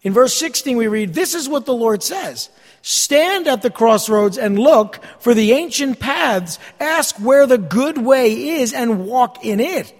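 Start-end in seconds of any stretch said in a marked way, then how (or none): 1.1-9.9 slow, loud, emphatic Bible reading; scripture reading. Real, not acted. Preacher reading God's command to the congregation, slow and proclaiming. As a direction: proclaim the verse, leaning on key words.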